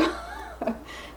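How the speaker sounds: high, airy, embarrassed giggle; breathy embarrassed laugh. Real, not acted. Vlogger embarrassed before her Spotify listeners, giggling. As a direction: laugh it off through tears. Embarrassed while crying on camera.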